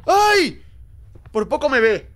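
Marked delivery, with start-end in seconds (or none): 0.1-0.6 sudden high yelp of fright; startled yelp. Real, not acted. Player nearly seen by the witch, startled yelp. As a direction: cry out as if nearly caught.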